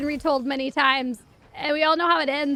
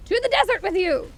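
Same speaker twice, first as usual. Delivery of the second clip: mock banishment; 7 semitones higher than usual. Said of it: "they're like".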